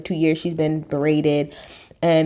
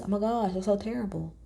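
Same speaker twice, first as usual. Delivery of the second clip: fake sympathy; 10 dB quieter than usual. Shown as a demonstration. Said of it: "I'll be like this".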